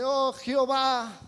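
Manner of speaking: unfaithful husband feigning tears at the altar; mock weeping prayer. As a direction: imitate a man weeping showily at the altar. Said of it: "y llora y dice".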